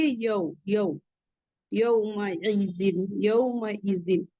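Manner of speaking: यौमइजिन slow, low, with a soft a-u glide; soft leen yau. Shown as a demonstration. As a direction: glide यौमइजिन softly and slowly. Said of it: "तो हम जल्दी से पढ़ेंगे यऊ". (नरमी से यौमइजिन)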